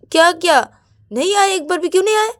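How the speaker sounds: high, loud exasperated shout; indignant outburst. Acted. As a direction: throw the question back in anger. Angry outburst at Payal.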